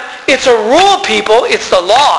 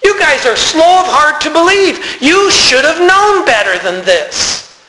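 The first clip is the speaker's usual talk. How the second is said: a hint of a stern, higher rebuke; point-blank rebuke; 6 semitones higher than usual. Jesus rebukes the disciples on the road, a touch stern. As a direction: scold them slowly, point blank. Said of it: "Told them point blank".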